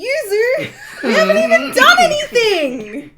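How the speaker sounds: laughing shout. Real, not acted. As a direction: yell it while laughing. Outraged at friend's game choice.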